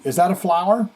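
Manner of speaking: normal talking voice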